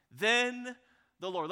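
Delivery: high, loud Then, short the Lord; dramatic cadence. Shown as a demonstration. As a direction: hit then hard. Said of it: "you can hear the cadence".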